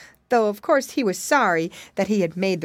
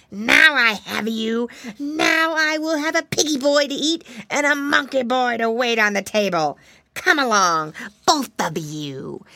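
bad alligator; 5 semitones higher, 4 dB louder than usual. A narrator's reading. Alligator seizing piggy boy and monkey, loud villainous gloating. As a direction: gloat as a menacing alligator with caught prey.

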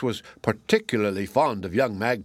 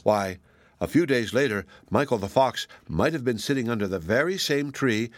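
plain reading voice

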